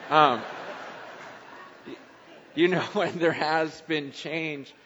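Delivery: chuckling awkwardly mid-sentence; embarrassed laugh breaking into speech. Real, not acted. Laughs after admitting failure.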